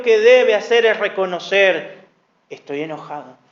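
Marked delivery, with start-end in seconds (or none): 2.5-3.4 quiet admission. Pretend. Example of admitting anger.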